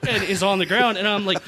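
ordinary delivery

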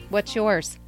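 usual speaking voice

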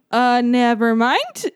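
"never mind" drawn out, rising like a question. Retold or imitated. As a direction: say "never mind" slowly, unsure, lifting "mind". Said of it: "said it like a question".

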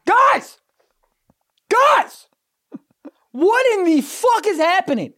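loud, very high outraged cry; heated outrage. Real, not acted. Telling friends about the clerk, loud high outrage. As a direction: cry out to the group in disbelief.

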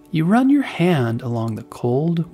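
ordinary delivery

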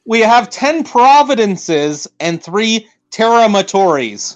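emphatic correction. Real, not acted. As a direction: spell it out firmly. Correcting provinces count.

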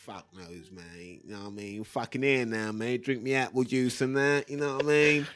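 lightly voiced cockney gym geezer; a hint of an old East End trainer; Terry. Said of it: "That wasn't Terry".

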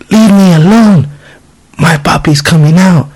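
put-on high-pitched little-kid protest; high child voice, retold; imitated young daughter. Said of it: "and I heard".

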